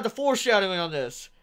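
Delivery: ordinary talk, nothing marked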